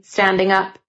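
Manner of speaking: plain reading voice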